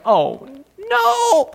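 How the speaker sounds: small, high squeak of dread; comic, softly high-pitched dismay. Acted. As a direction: squeak out the 'no' as if caught. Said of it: "the woman's like".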